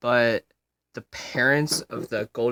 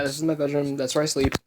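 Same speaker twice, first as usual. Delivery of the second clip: ordinary delivery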